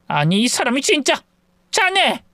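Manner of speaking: rising, exasperated cry of his name; exasperated outburst. Acted. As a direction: at the end of her patience. Mother-in-law exasperated. (어이없어 터지는 외침)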